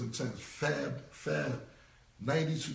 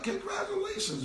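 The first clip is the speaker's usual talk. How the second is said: mock congratulations. To Jim about his crying story, sarcastic high congratulations. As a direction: stretch out "congratulations" way up high, teasing.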